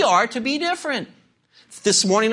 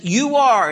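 "You are" punched out louder, both words firm; emphatic stress; 4 dB louder than usual. Shown as a demonstration. Preacher quotes Jesus to the congregation, "You are" said emphatically. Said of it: "and that's emphatic".

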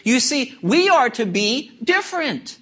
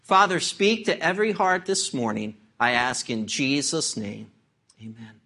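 low, subdued praying voice; closing prayer; 7 semitones lower, 4 dB quieter than usual. Real, not acted. Preacher asks God to speak to every heart, hushed prayer. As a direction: pray gently, sinking lower and softer to the Amen.

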